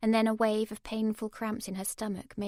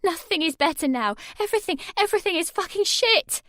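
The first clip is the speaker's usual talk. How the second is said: high, louder tearful fury; crying rage; 10 semitones higher, 10 dB louder than usual. A narrator's reading. Sammy to her mum, furious and crying. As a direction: spit it out through tears.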